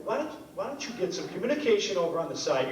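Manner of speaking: ordinary talk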